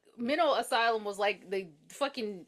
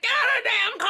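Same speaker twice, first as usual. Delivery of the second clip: much higher, louder yell; furious shout; 13 semitones higher, 8 dB louder than usual. Retold or imitated. Newberry yelling at the kids to get out. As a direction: bark it as an angry order. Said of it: "He's like".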